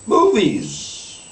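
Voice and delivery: a hint of a showman announcing "Movies."; [name]'s triumphant reveal. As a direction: proudly announce the word as if unveiling a surprise. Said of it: "[name] names it".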